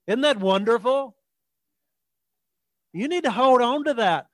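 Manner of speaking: ordinary delivery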